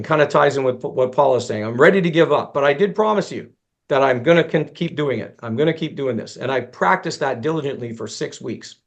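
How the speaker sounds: ordinary talk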